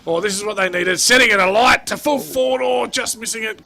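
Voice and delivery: building to a high, loud call; real match excitement, peaking then easing; excited play-by-play. Real, not acted. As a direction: lift the pitch as the attack nears goal. Calling a fast attacking play.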